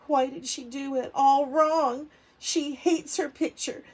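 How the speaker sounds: plain reading voice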